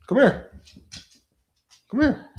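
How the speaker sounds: high sing-song call to the dog; sweet dog-calling voice. Real, not acted. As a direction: coax the dog over, way up high. Coaxing dog with treat.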